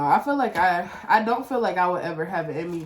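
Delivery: normal talking voice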